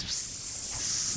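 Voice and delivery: breathy whiss like wind through a cracked window; unvoiced hiss of air. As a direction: blow the sound out without voice.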